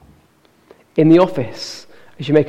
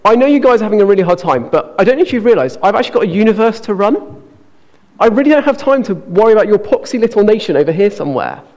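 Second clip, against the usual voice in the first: high, fast and offhand, brushing them off; dismissive, too-busy God; 5 semitones higher, 5 dB louder, faster than usual. Pretend. God brushing off the exiled Israelites, fast and offhand. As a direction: rattle it off like a busy boss waving people away. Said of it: "He could say".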